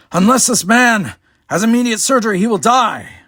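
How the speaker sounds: high, loud desperate shout. Acted. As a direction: yell it up to the box, out of breath. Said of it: "shouted up to Numer Ein".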